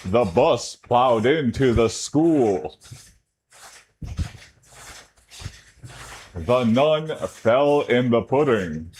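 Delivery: slow, every word crisp; "The bus plowed into the school" with each syllable spelled out slowly; over enunciating. Shown as a demonstration. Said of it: "Enunciate every word when you say something".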